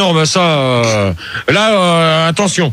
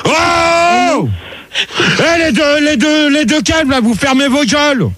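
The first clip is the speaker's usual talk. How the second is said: high, angry yell at noisy callers; long yelled "oh", then a raised-voice telling-off; real shouting; 5 semitones higher, slower than usual. Real, not acted. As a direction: holler to shut them up, pitch raised. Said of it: "Crie pas, [name]".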